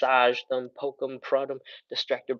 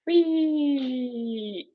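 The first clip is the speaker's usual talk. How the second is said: a high, gleeful whee as she folds forward; playful whee; 12 semitones higher than usual. Real, not acted. Instructor folding forward for viewers, a high gleeful whee. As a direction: sing out a high whee while dropping into the stretch.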